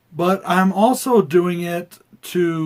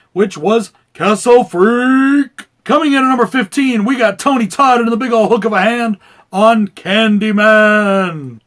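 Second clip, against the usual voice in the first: ring announcer; 5 semitones higher, 9 dB louder than usual. Acted. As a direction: hype it like a boxing announcer. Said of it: "Is this voice getting annoying yet?".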